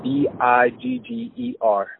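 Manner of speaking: B I G G E R spelled slowly letter by letter; spelling it out. Shown as a demonstration. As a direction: spell B I G G E R one slow letter at a time. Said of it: "D I S K?".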